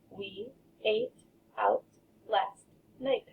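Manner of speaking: each word set apart with pauses. Shown as a demonstration. Teacher separating words so children can count, slow. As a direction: space the words out to count them. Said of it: "count how many words".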